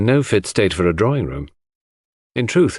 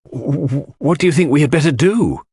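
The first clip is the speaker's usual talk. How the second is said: stammering panic; 6 semitones higher than usual. A narrator's reading. Lawrence stammers to his brother at the locked door. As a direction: stumble over the first word in worry.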